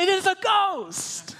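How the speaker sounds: high, slow cry of "It is a ghost!"; mock frightened cry. Disciples on the boat cry out at Jesus, high and mock terrified.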